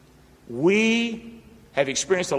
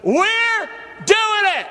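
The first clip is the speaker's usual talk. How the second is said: high, loud, drawn-out cry; fired-up preacher shouting; louder call-and-response shout; 6 dB louder, slower than usual. Real, not acted. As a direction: push the church to top the last one. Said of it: "Can you do it?".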